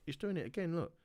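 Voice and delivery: ordinary delivery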